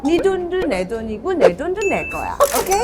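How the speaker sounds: lightly voiced Kang Ju-eun; Kang Ju-eun impression. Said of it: "주성 아빠".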